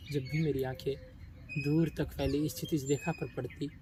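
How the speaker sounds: low, soft inward confession; subdued regret. Acted. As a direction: sink into quiet, wistful regret. Character regrets straying from his world.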